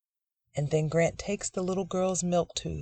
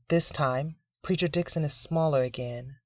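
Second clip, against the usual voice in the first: ordinary delivery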